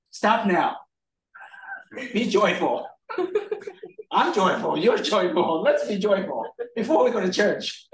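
pushy, laughing demand for cheer; parent's mock command. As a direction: order a whining child to cheer up, half joking. Voicing himself scolding son.

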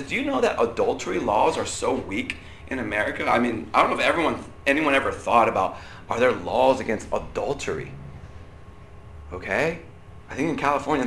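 usual speaking voice

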